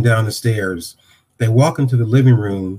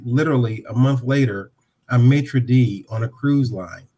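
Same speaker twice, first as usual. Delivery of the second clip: ordinary talk, nothing marked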